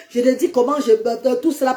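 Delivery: ordinary talk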